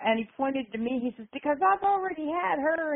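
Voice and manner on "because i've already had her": high, sustained mock promo voice; hammed-up threat, pitched way up; wrestler's challenge. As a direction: imitate a heel calling out his rival. Retold wrestler's boast, promo continues.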